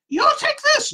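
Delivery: put-on very high, squeaky little old lady voice; squeaky old woman. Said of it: "this high-pitched, squeaky voice".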